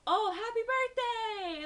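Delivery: loud, high, drawn-out mock cheer; excited greeting. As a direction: imitate an over-excited well-wisher. Buddy's birthday greeting retold.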